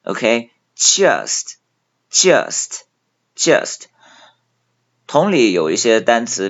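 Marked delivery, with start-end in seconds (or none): 2.1-3.8 Just with a flat spread vowel; 扁平口型读just; just correctly. Shown as a demonstration. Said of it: "嘴巴拉平发".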